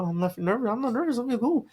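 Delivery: high-pitched retold denial; mock insistence. Groom assuring his friend on wedding morning, mock insistent. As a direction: pitch climbs with each repeated denial.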